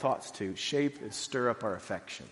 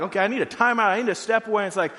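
loud, high, laughing at being worked up; worked-up inner voice; 7 semitones higher, 6 dB louder, faster than usual. Retold or imitated. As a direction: talk yourself down while still heated. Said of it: "we need to, like".

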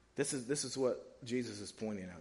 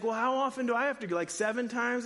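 eager question; 9 semitones higher, 5 dB louder than usual. Retold or imitated. Peter asks Jesus.

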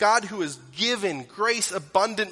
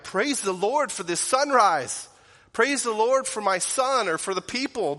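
joyful praise; 5 semitones higher, 5 dB louder than usual. Retold or imitated. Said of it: "some of you are saved and you're like".

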